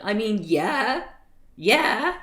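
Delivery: loud, high incredulous rant; exasperated rant. Acted. Reader's exasperated outburst at story.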